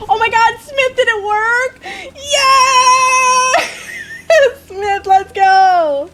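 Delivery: high, loud elated shout with drawn-out words; ecstatic, squealing celebration; real excitement. Real, not acted. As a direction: cheer wildly at great news. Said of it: "I'm so excited".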